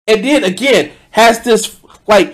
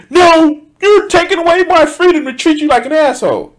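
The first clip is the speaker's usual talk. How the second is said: mocking outrage; 10 semitones higher than usual. Mocking conservatives, hammed-up indignant high voice. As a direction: parody an indignant bigot.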